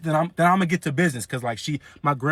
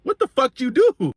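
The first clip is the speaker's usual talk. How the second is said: high, loud, drawn-out incredulous question; shocked disbelief; 8 semitones higher, 5 dB louder than usual. Retold or imitated. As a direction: blurt it out in acted shock. Shocked at aunt's probation.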